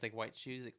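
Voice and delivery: normal talking voice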